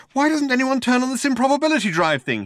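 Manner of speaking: high, urgent voice; frantic suggestion. A narrator's reading. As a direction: plead for it as time runs out. Man has desperate idea before impact.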